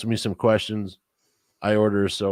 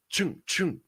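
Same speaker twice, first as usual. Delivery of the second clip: imitated money noise; 10 semitones higher, 5 dB quieter than usual. Imitated sound of paying.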